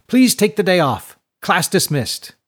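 high, loud dismissal; elated rector. A narrator's reading. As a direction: send the class off in high spirits. Rector waves class away happily.

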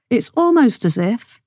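"almost" drawn out with pointed emphasis; stress on almost. Real, not acted. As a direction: slowly lean on the word "almost". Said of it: "I emphasize the word almost".

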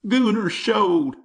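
high, wavering voice thick with twang; wavering twangy bully. A narrator's reading. Schoolyard bully taunting Jimmy in a high wavering twang. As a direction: twang it out like a badly tuned banjo. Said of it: "high-pitched voice full of more twang".

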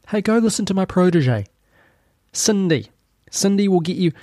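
high put-on Klaus voice; voicing Klaus. Host mocking Klaus with orders for the viewer. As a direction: play Klaus handing out orders. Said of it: "Did Klaus possibly send you".

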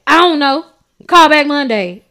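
loud dismissive quote. Retold or imitated. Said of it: "she was like".

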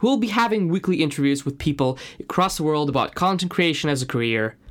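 ordinary talk

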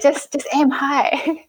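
high, slow, giggly quote; amused retold advice. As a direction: draw it out high on the edge of laughter. Voicing friend's advice, laughter follows.

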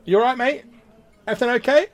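high, loud worried barmaid voice; concerned barmaid. Acted. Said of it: "she's gone".

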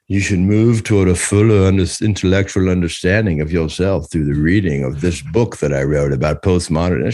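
pompous academic voice, lightly done; mock professor. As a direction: parody a smug atheist lecturer, dropping low. Mocking an atheist professor.

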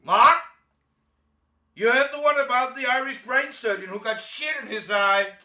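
high, loud taunting call; calling after him. Pretend. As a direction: yell the joke after him as he leaves. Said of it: "Johnny calling after me".

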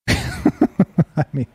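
a soft, real laugh into the words. Real, not acted. Streamer laughing at how the article classifies deaths, soft laugh. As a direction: laugh under your breath at the absurdity. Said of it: "I'm not laughing 'cause people died".

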